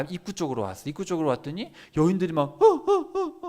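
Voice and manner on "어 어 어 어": high loud imitated wailing cries; hammed-up keening sobs; mock wailing. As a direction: wail like mourning women. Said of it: "울고 있는 거예요".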